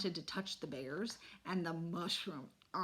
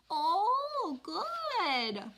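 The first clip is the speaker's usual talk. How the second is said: put-on toddler voice; 12 semitones higher, 4 dB louder than usual.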